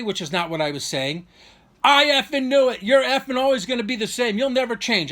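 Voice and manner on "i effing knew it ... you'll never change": loud, high reenacted outburst; worked-up mock tirade; retold angry yelling. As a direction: imitate a brother blowing up, easing slightly at the end. Continues the brother's screaming tirade.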